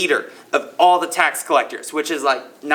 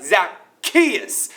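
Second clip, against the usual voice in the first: Zacchaeus drawn out in a put-on accent, lifted at the end; 2 semitones higher than usual. Shown as a demonstration. Youth speaker showing the crowd how to say the name, put-on accent. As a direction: put an exaggerated accent on Zacchaeus, ending on a rise. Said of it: "you gotta say it with, like, that accent".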